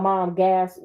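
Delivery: ordinary delivery, nothing marked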